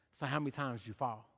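soft, fast casual question; quick quiet question. Retold or imitated. People at fire ask question.

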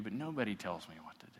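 a fast whispered boast; whispered defiance. Acted. The proud king whispering his defiance to himself. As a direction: whisper it like a king who answers to no one. King defying God, acted quote.

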